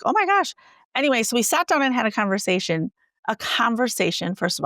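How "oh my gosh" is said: high shocked oh my gosh; appalled gasp. Retold or imitated. Colleague appalled at the card change, high shocked exclamation. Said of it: "he was appalled".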